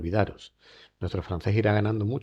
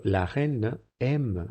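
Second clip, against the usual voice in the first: la reine aime drawn out slowly; very slow model; 2 semitones higher, much slower than usual. Shown as a demonstration. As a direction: stretch each word for students. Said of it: "decimos aime".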